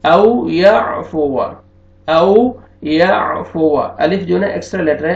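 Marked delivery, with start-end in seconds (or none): 0.0-3.8 औ याफुवा drawn out very slowly, the extra alif left silent; slow demo of औ याफुवा, silent alif. Shown as a demonstration. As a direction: stretch औ याफुवा slowly without reading the alif. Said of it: "आपने इसको नहीं पढ़ना".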